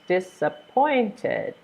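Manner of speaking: slow dis-ap-POINT-ed, POINT lifted; disappointed syllable by syllable, stress on POINT; third-syllable stress. Shown as a demonstration. Teacher sounding out disappointed, slow, stressed on POINT. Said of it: "stress is on the third one".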